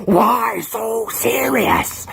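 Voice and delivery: slow, drawn-out Joker line. Retold or imitated. Drive-thru worker quoting the Joker, slow and drawn out. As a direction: drag out the Joker quote slowly. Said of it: "the person in the drive-thru is like".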